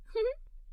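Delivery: high little giggle; giggle again. Acted. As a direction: giggle like a delighted kid. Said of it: "I giggled again".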